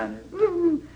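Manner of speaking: high mock swooning ooh; a hammed-up sighing ooh from the crowd. Preacher mocking the weeping crowd, high swooning ooh.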